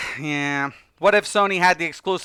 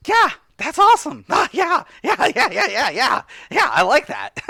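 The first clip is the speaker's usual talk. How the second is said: delighted yeah; 6 semitones higher than usual. Real, not acted. Delighted at the deal.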